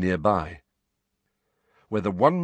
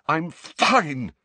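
choked; 6 semitones higher than usual. Acted. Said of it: "Harry choked".

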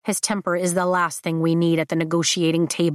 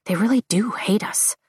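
whisper; 3 semitones higher than usual. A narrator's reading. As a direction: breathe it with no voice at all. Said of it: "My voice drops to a whisper".

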